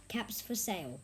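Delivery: ordinary delivery, nothing marked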